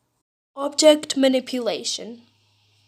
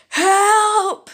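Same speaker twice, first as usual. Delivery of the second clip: much higher and louder cry; desperate screech; screeching for help; 9 semitones higher, 8 dB louder than usual. Acted. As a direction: shriek for help from afar. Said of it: "A screech comes".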